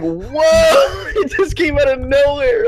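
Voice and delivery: high, loud startled shout; shocked whoa. Retold or imitated. Friend retelling his shocked reaction to the creepy joke, high laughing shout. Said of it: "I was like".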